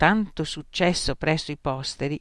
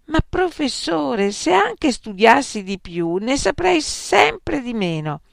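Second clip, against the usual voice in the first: plaintive plea; 9 semitones higher, 5 dB louder than usual. Acted. Student pleading with furious examiner.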